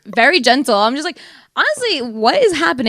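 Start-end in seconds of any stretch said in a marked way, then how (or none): 1.5-2.9 high, incredulous question; retold bewilderment. Woman reacts to a stranger's massage, baffled. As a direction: pitch it up, baffled.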